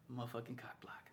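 very soft, low swearing under the breath; muttered grudge. Real, not acted. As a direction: grumble it almost in a whisper. Grumbles about boss spoiling his flirting.

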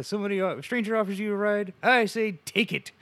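high, loud comic quote; hammed-up cartoon-style advice; mock public announcement. Joke advice in Simpsons quote.

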